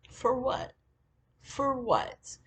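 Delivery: high, indignant repeated question; retold high-pitched disbelief; incredulous challenge. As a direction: demand a reason in disbelief.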